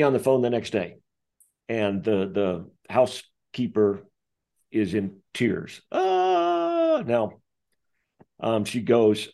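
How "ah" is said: high wailing ah of a crying woman; imitated crying wail, much higher; mock sob. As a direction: wail like someone in tears. Housekeeper in tears on phone.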